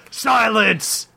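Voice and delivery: high commanding demon shout. A narrator's reading. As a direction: shout them all into silence. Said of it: "shouted Arudan".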